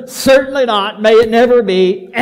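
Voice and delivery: loud, emphatic, almost laughing refusal; emphatic Paul denial. Retold or imitated. Paul firmly rejecting the false argument, loud and emphatic. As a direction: bark it out, shutting the idea down. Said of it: "he responds with an answer".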